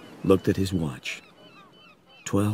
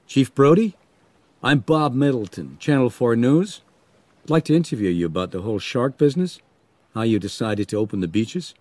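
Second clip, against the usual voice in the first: raised, brisk newsman's pitch; TV reporter; 4 semitones higher, 3 dB louder, faster than usual. Acted. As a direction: introduce yourself like a keen interviewer. TV reporter introduces himself.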